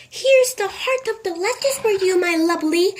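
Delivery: high, fond voice for her pet; a sweet Mrs Silver voice; doting old lady. A narrator's reading. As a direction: coo over the tortoise at breakfast. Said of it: "she was saying".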